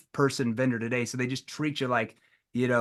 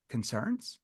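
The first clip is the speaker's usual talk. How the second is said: confused; 3 semitones lower, 9 dB quieter than usual. Shown as a demonstration. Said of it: "in a confused tone".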